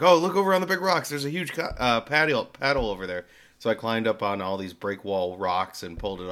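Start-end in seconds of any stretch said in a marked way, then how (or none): 0.0-3.3 high, fast and louder; excited sister. Retold or imitated. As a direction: call it out with excitement. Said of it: "my sister's like".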